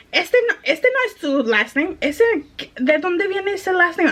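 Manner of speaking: high-pitched mock questioning; disbelieving customer. Retold customer doubting the surname.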